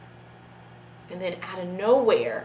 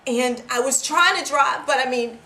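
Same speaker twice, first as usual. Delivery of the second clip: loud, higher-pitched frantic account; panicked retelling; 4 semitones higher, 10 dB louder than usual. Acted. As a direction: build from raised voice to high, panicked near-shout. Reliving the crash in panic.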